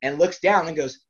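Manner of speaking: ordinary talk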